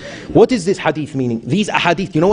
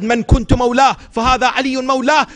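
raised proclamation; 8 semitones higher than usual. Retold or imitated. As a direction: ring the hadith out like a declaration. Boy proclaims the hadith.

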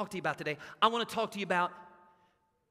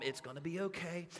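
much softer, calm and soothing; 5 semitones lower, 11 dB quieter than usual. Retold or imitated. Mother calming injured son, soft and soothing. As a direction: settle the hurt child gently. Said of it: "all calm".

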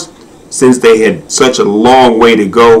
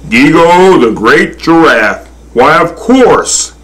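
higher, drawn-out storybook voice; grand recognition; 6 semitones higher than usual. A narrator's reading. Good Lord recognising the giraffe, grandly pleased. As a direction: greet him grandly, stretching the last words.

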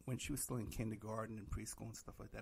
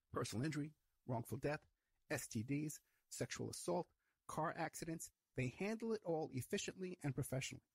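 ad read; 4 semitones higher than usual. Acted. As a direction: sell the law firm to listeners. Host reads sponsor ad.

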